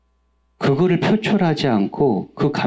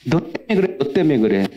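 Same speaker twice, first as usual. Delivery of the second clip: 1 mock quarreling spouse; 3 semitones higher than usual. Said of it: "부부싸움 할 때 그러지 않습니까?".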